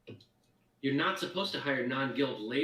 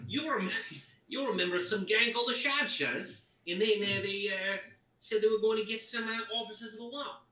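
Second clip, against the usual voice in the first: put-on toned-down Cockney accent; 9 semitones higher, 4 dB louder than usual. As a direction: pull the Cockney back a bit. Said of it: "this is too cockney".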